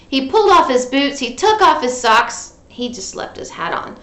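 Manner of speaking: ordinary delivery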